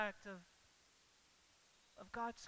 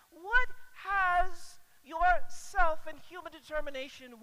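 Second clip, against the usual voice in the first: louder, higher, pressing question; pointed rebuke; 5 semitones higher, 13 dB louder than usual. Acted. Jesus asking the disciples what self-will won them, pressing. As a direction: press them on their stubborn choices. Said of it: "as if Jesus is saying".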